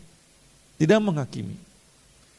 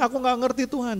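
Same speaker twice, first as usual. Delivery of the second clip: high, pleading excuse-maker to God; whiny excuse; 11 semitones higher than usual. Retold or imitated. Believer making excuses to God, high whiny plea. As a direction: act out the lame excuse, pitched high. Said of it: "Jangan berdalih".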